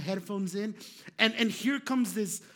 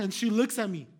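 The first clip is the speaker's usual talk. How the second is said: usual speaking voice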